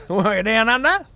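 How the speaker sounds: put-on high, laughing parody of an Australian accent; mocking Aussie accent. Streamer mocks the game character's Australian line in an Aussie accent. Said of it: "sounds like a Yank trying to be a knocker".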